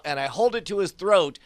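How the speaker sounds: normal talking voice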